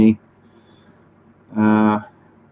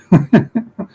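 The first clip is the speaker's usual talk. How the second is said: short laugh; 10 semitones higher, 4 dB louder than usual. Real, not acted. Laughs at own claim.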